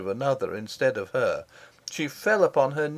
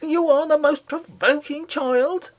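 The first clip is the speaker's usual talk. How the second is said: high, loud scolding nurse, slowed down; angry cry; 6 dB louder than usual. A narrator's reading. Nurse cries out angrily at the misbehaving princess. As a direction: cry it out at the child like a furious nurse. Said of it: "cried her nurse".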